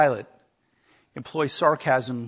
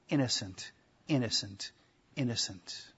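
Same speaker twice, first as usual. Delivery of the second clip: innocent, innocent, innocent, each said softer and falling; solemn repetition; 4 semitones lower, 8 dB quieter than usual. Shown as a demonstration. Preacher stressing Pilate's verdict, soft measured repetition. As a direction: lay down innocent three times, quietly, for weight. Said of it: "for emphasis".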